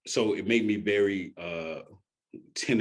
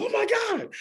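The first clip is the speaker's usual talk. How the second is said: much higher, squeaky imitation of a crying friend; mock tearful gasp; 4 dB louder than usual. Imitating his best friend hearing the news, mock tearful. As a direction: play the shocked, teary best friend lightly. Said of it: "She started crying immediately".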